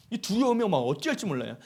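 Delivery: normal talking voice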